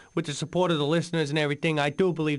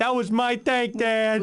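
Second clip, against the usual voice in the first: raised, wailing lament; upset; 6 semitones higher, slower than usual. Acted. Grown man grieving his toy tank to dad, mild wail. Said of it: "get a hold of yourself".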